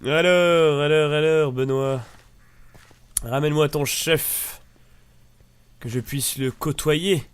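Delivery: loud, drawn-out playful hail; mock-grand summons. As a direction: call out slowly with mock pomp. Hailing a game character, mock-grand.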